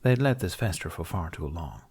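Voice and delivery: usual speaking voice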